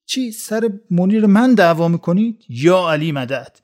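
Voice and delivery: yelling. Acted. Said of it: "رسول داد کشید که".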